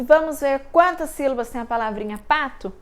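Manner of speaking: ordinary delivery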